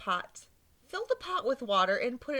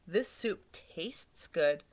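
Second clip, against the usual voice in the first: slow, lower savour; 4 semitones lower, much slower than usual. Acted. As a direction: drag out the taste. Old lady tastes soup.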